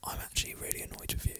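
fast hissed whisper quote. Retold or imitated. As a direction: whisper it like you're seething. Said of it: "you actually said to me".

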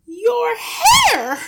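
very high, piercing scream; wife screaming in horror; horrified shriek. A narrator's reading. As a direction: shriek it out in shock, stretching the last word. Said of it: "the mother was shrieking".

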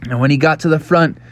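ordinary delivery

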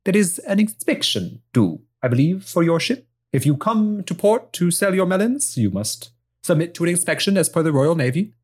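put-on brisk official speaking in a Spanish accent. Navy woman demanding a ship inspection, Spanish accent. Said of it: "sound of a young Spanish woman".

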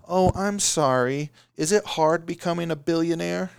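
mock them like a crybaby; whiny crybaby voice. Investor mocking founders who complain, whiny crybaby voice. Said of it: "like, a whiny crybaby voice".

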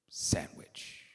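soft whisper of the word; whispered punchline. Acted. Preacher whispering the sermon title to the church.

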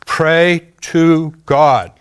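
slow, loud "Pray to God"; "Pray to God" drawn out with weight; commanding inner voice. Retold or imitated. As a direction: intone it like a voice from the chest. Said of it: "The voice said".

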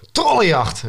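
high, ringing cry within a reading; called out. A narrator's reading. Narrator voices the boy starting a troll hunt, called out. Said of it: "riep ik".

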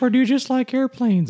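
high mock voice; high mock voice of a stranger asking about the shirt; quoting a stranger. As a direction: imitate her curious voice. Retelling a stranger's question.

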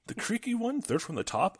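high-pitched, startled British question; astonished, high British gasp. A narrator's reading. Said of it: "I gasped".

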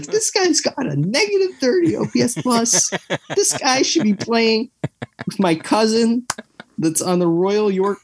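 high, scornful put-down; mocking rant. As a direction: sneer at a bad player. Mocking his own past rant.

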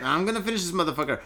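rattled off fast, mock-defiant. Real, not acted. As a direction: blurt it out quickly, half joking. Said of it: "repeat, faster and defiant".

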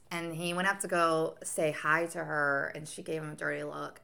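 usual speaking voice

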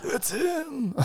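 high, hushed character voice; imitating Raph. Turtle revealing who it is at the end, hushed imitation. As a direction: put on a soft, high-pitched movie impression. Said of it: "and Raph goes".

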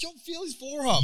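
high-pitched imitation of an eager friend; excited mock call. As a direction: call passersby over in a high voice. Imitates friend calling to passersby.

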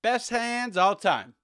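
sneering at a receiver dropping passes; mocking praise. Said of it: "I was just thinking, like".

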